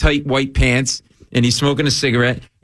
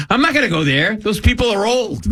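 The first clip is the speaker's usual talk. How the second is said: higher, huffy imitation of his mother; indignant old mother; 8 semitones higher than usual. Mother refusing the senior center, huffy mock. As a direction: play the offended ninety-six-year-old, lightly. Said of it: "She actually said to me".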